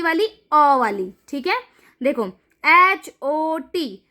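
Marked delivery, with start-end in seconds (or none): 2.6-4.0 slow, letter by letter; slow teacher spelling-out. Shown as a demonstration. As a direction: spell it out slowly for the class. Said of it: "तो बीच में अकेला है क्या".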